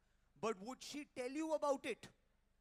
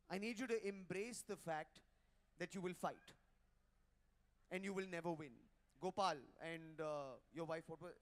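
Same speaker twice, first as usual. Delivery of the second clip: normal talking voice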